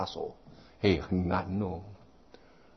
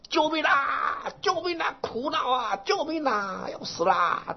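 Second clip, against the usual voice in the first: high, drawn-out desperate cries for help; shouting for help; 4 dB quieter, slower than usual. Retold or imitated. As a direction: wail for rescue like a blind man hanging over a drop. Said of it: "他在那大声地喊".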